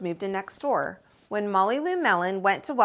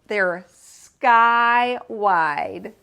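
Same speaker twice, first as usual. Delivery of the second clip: very slow, stretched and louder; slow emphasis; 7 dB louder, much slower than usual. Acted. Girl boasting about the sky to her neighbor, slow and stretched. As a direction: stretch it to show how wide.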